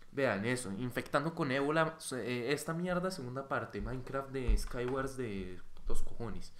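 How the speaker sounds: ordinary talk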